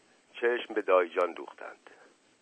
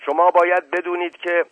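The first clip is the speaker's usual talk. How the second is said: emphatic; 8 semitones higher, 8 dB louder than usual. Acted. Uncle starts an objection, interrupted.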